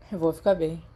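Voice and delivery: ordinary delivery, nothing marked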